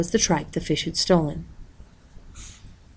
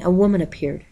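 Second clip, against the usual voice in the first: ordinary delivery, nothing marked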